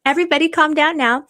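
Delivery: gleeful call to the chat; excited. Real, not acted. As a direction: hype up the chat. Excited at big donation.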